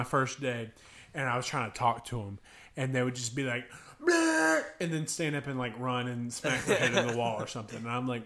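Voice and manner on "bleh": put-on high loud bleh of a goofy kid; silly kid noise. Fifth graders ignoring the counselor, with a high goofy bleh.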